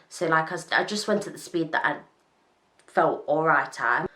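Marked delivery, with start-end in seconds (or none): none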